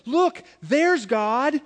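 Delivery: put-on high, slow, delighted child's cry; drawn-out, thrilled discovery; excited little girl. Pastor voicing a girl who spots his picture, slow and thrilled. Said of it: "the little girl got really excited".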